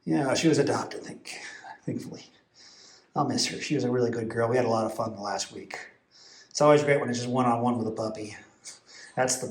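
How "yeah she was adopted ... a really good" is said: whispering. Real, not acted. Hushed aside near sleeping dogs.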